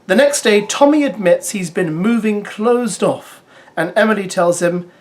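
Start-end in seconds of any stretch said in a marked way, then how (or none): none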